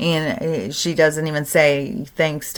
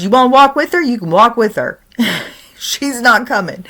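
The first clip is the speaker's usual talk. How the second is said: louder, higher sarcastic retort; sarcastic grandpa; 6 semitones higher, 5 dB louder than usual. Retold or imitated. Grandpa daring granddaughter to walk too, loud sarcasm. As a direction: dare her to walk.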